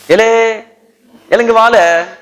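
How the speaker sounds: loud, high Tirunelveli Tamil call; Tirunelveli dialect. Shown as a demonstration. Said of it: "When you come to Tirunelveli".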